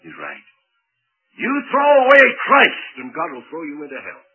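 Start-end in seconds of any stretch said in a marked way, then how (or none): 1.4-4.2 loud preaching. Real, not acted. Preacher's warning at full force.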